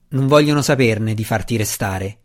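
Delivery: ordinary delivery, nothing marked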